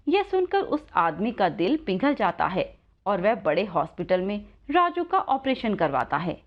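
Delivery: usual speaking voice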